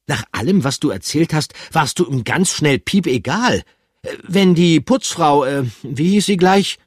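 hohe, energische Figurenstimme; hoch und bestimmt einwenden; resolute Figur. Acted. Pikandu voiced, hands on hips. (hohe, energische Stimme)

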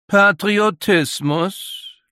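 high, slow, sneering question; a mocking, drawn-out high query. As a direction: drag the word out with open scorn. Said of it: "Die dünne Stimme des Dritten klang höhnisch".

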